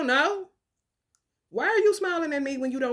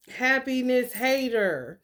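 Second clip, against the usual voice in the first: ordinary talk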